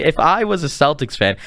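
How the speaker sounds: usual speaking voice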